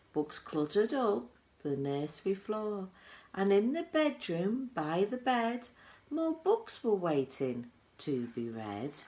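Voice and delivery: bouncy chant with the beat; rhythmic poem recitation. A narrator's reading. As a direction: recite it like a lively rhyme. Reciting the Oompa Loompa song.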